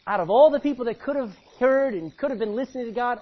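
fiery preaching; preaching at full force. Acted. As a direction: build the point hard.

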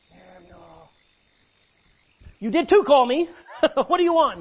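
put-on boy laughing at the old priest. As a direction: laugh at the joke as you ask. A soft call, then Samuel laughing.

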